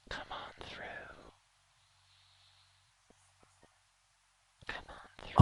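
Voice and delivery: very soft eerie whisper from the fog; faint whispers. Acted. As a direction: whisper it faintly, luring them in. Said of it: "you hear faint whispers in the fog say".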